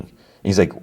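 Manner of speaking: ordinary talk, nothing marked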